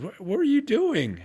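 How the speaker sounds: high, sharp startled question, imitated; mock-startled protest to his wife; startled what. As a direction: caught off guard by the punch.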